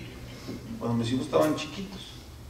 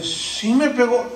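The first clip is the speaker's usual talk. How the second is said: put-on little girl answering; 7 semitones higher, 3 dB louder, slower than usual. Daughter answers father.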